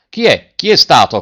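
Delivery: loud high shouted question; angry crowd shouting the question. A narrator's reading. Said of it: "continuava a gridare la gente".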